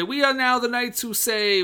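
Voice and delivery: a hint of a grand knight proclaiming; knight quote. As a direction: announce it like a pompous knight. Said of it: "when they say".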